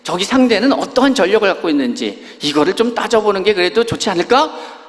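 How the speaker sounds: a hint of Moses weighing his options; imagined Moses reasoning. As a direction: talk it through like a cautious leader. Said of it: "하는 얘기로 들려요".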